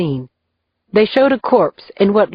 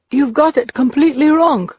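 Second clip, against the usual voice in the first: higher, tense protest; frightened denial, pitched up; panicked; 7 semitones higher than usual. A narrator's reading. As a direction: blurt it out in a panic.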